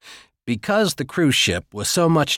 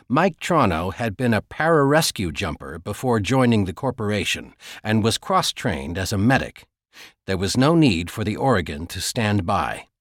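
ordinary delivery

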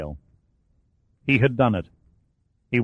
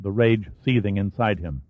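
ordinary delivery

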